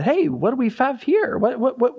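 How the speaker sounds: high-pitched, playful mock surprise; puzzled discovery. Temple cleaners find a dusty scroll, puzzled surprise. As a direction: stumble upon something odd, pitch jumping up. Acting out finders of lost scroll.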